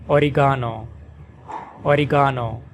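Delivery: oregano as "o-ri-GAH-no", stress on ga, open ah; British oregano. Shown as a demonstration. Teacher demonstrating oregano in British English, stress on ga. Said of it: "with the stress on the third syllable".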